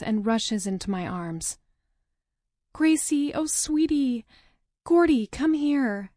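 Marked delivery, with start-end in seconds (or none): 2.7-6.1 comforting siblings. Acted. Comforting her crying siblings.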